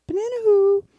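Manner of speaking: high, loud mock exasperation; irritated joke responder. Listener sitting through a repeated knock knock joke, mock irritated. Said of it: "they're getting really irritated with you".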